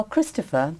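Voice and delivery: ordinary talk, nothing marked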